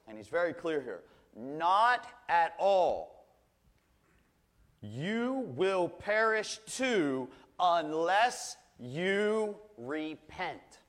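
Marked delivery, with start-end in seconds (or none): 1.3-10.8 very slow, heavy voice of Jesus warning; stern warning. Acted. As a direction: lay each word down slowly like a final verdict.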